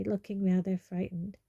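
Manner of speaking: usual speaking voice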